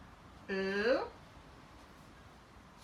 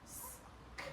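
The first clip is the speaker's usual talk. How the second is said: whisper; 15 dB quieter than usual. Shown as a demonstration. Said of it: "that was where it got tricky".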